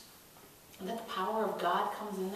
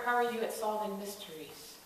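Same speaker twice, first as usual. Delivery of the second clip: normal talking voice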